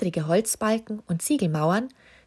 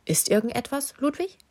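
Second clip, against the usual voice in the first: a touch of worry, rising higher on the name; unsure; 7 semitones higher than usual. A narrator's reading. Susi asks the nervous king, a little unsure. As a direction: ask timidly, half afraid of the answer. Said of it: "antwortete sie unsicher".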